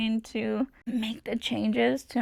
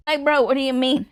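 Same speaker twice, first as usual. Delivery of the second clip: much louder, incredulous bro; loud, fed-up retort; exasperated; 8 dB louder, faster than usual. Real, not acted. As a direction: push back in disbelief. Annoyed at doctor's nonchalance.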